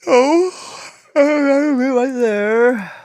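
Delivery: loud, high, long-drawn wail; near-tears wail. Real, not acted. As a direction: cry out in despair, stretching the words. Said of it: "I was about to cry".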